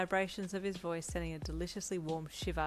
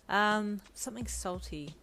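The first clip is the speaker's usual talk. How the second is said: calling out; 4 semitones higher, 6 dB louder, slower than usual. A narrator's reading. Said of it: "you called out after him".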